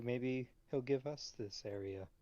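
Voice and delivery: ordinary talk, nothing marked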